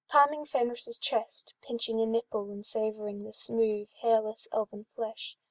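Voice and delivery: ordinary delivery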